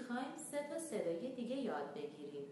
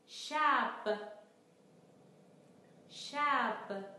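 شب said clearly and loudly, twice; model word read; 4 semitones higher, 3 dB louder, much slower than usual. Shown as a demonstration. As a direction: read شب carefully for learners. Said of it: "گوش کنید و بخوانید". (خواندن واضح و بلند)

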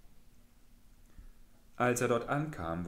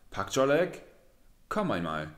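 louder call after him; 3 semitones higher, 6 dB louder than usual. Acted. Pak Dungu calls after the departing Pak Cholek, raised voice. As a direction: hail him as he walks off. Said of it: "Schnell rief er Pak Cholek nach".

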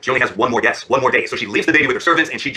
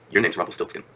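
knowing princess; 7 semitones lower, 5 dB quieter than usual. Acted. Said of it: "looks him in the eye, and she says".

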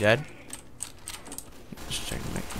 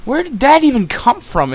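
baffled outburst; 10 semitones higher, 8 dB louder than usual. Real, not acted. Baffled after being killed.

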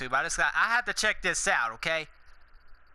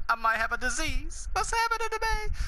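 jumps much higher into a silly squeak; mock panic squeak; 11 semitones higher than usual. Goofy vlogger jokes he is sick, squeaky mock panic. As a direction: play fake alarm with a cartoon-high voice. Giggly goofy self-mockery.

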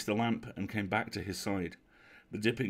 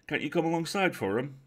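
higher, louder question across water; raised-voice invitation; calling out; 7 semitones higher, 5 dB louder than usual. Acted. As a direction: hail the unseen boatman coaxingly. Will calls to the boat.

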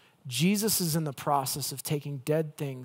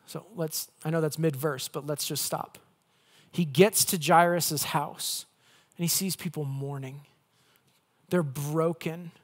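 usual speaking voice

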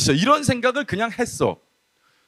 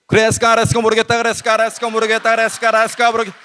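very fast, run-together chant; mock speaking in tongues; much faster than usual. Youth preacher imitates his friend praying in tongues, rapid monotone babble. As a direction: rattle it off until the words blur. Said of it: "나는 방언을".

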